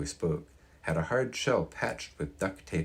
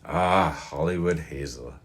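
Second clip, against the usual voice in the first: slow, wistful sigh of a name; drawn out with fond regret; old man sighing over a lost love; 5 dB louder, slower than usual. Acted. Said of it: "Mister Rozier sighed".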